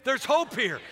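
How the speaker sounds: hope called out loud and high; preached repeat. Real, not acted. Pastor leading congregation, loud call-and-response. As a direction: lead the crowd in the line. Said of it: "Everybody say that".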